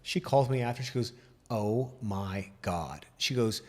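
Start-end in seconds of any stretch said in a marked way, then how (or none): 1.5-3.1 low, soft, very drawn-out amazement; hushed slow-motion disbelief; stunned awe. Retold or imitated. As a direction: imitate a stunned mom, word by slow word. Mom amazed after her visit.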